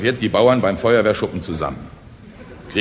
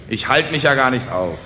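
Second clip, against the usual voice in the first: plain reading voice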